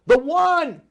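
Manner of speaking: high, booming triumphant shout; acted yell. Somebody at the meeting yells the last fix, acted yell. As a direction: yell it as the final answer. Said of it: "somebody yells".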